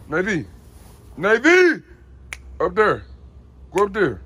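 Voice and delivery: high, loud call and order; loud command. Real, not acted. Owner ordering Navy up there, loud calls. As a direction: call the dog sharply up.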